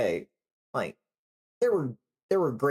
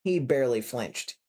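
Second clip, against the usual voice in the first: usual speaking voice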